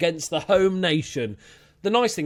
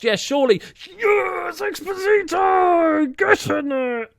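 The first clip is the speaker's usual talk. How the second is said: ecstatic shout, much higher; goal celebration; 6 dB louder, slower than usual. Real, not acted. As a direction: scream the goal with joy.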